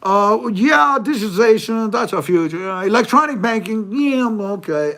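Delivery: high, loud put-on voice mocking an official. Mocking the official who answers the question, in a high loud voice. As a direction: ham up a smug bureaucrat's answer.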